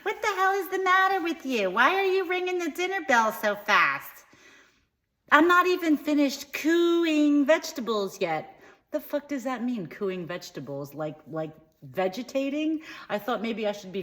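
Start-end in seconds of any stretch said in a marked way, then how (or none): 0.0-8.6 high, loud scolding screech; hammed-up mother's scream, far higher. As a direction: shriek at your child like an exasperated mother. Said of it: "she screamed".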